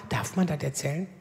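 low, soft self-doubt; quiet doubtful question. Retold or imitated. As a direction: ask yourself softly.

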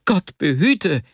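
high-pitched cry of alarm. A narrator's reading. Vasja hearing his friend will intervene, alarmed cry. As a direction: cry out in dread, voice jumping up. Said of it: "schrie Vasja auf".

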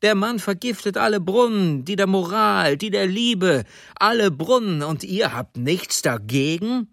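high, heated rebuke; outraged judge hurling accusations at the crowd; indignant judge. Acted. Judge berates jeering courtroom.

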